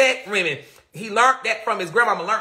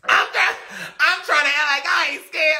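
higher, teary laughter over the words; laugh-crying; 6 semitones higher than usual. Real, not acted. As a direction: giggle through tears at yourself. Laugh-crying retelling the fear.

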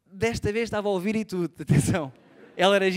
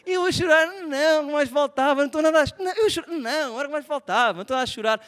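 higher, indignant panicked denial; flustered denial; 5 semitones higher, 4 dB louder than usual. Retold or imitated. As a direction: protest too much, voice jumping up. (negação aflita e indignada, mais aguda)

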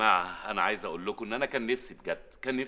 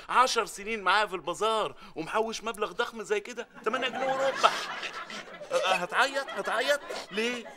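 much higher, incredulous hammed-up rant; high mock outrage; 9 semitones higher than usual. Boss ranting at his employee over tiny savings, high and hammed up. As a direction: ham up the disbelief.

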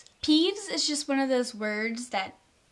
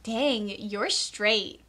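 mock slow drawl; 5 semitones lower, much slower than usual. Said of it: "be like".

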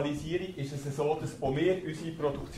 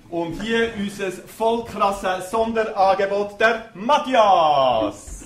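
loud, slow hard-sell pitch; hard-sell announcer; 4 semitones higher, 9 dB louder, slower than usual. Acted. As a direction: draw out der Matthias like a shouted ad. Loud sales pitch for a bargain.

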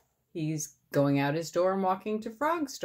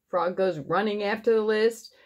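plain reading voice

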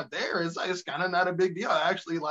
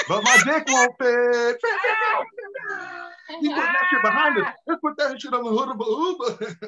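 much louder and higher, shouting through laughter; loud laughing punchline; 10 semitones higher, 7 dB louder than usual. Acted. Comic shouts his own punchline to the crowd, laughing loudly. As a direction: yell it out while cracking up.

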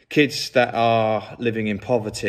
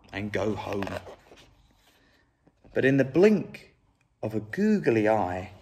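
lively, higher storybook voice; reading to children; 7 semitones higher, slower than usual. A narrator's reading. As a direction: read it like a bedtime picture book.

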